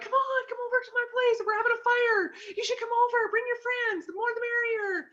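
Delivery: very high, excited invitation to a party; bubbly party host. Retold or imitated. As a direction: gush like an eager hostess inviting friends. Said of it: "So they're like".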